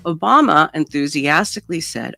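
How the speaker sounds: ordinary talk, nothing marked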